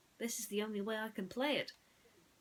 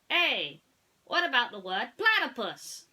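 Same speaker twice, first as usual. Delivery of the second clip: Woody insisting; 5 semitones higher, 10 dB louder than usual. Acted. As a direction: lay it out like a cowboy who knows. Woody asks.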